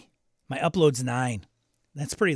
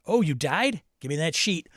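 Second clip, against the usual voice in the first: normal talking voice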